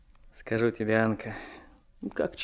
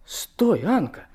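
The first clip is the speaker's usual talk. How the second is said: higher, louder call to stop her; startled call; 9 semitones higher, 6 dB louder, slower than usual. Acted. Vanyushka calls Anka back, surprised. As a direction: call out as she pulls away.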